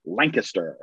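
Lancaster said LANK-uh-ster, middle reduced; Pennsylvania pronunciation. Shown as a demonstration. Host gives the Pennsylvania Dutch way, local pronunciation. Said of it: "if you're Pennsylvania Dutch".

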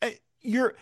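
high-pitched, incredulous reproach; exasperated appeal. Pretend. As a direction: chide her as if she were present. Exasperated mock appeal to a character.

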